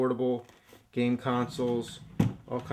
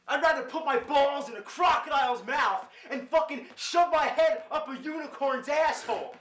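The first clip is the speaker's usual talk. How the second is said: high cartoon voice, ranting and laughing; raging gamer; 14 semitones higher than usual. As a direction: rant like a furious cartoon gamer.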